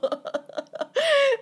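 high burst of laughter; big laugh. Real, not acted. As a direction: laugh out loud. Laughing at the police memory.

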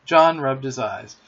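ordinary delivery, nothing marked

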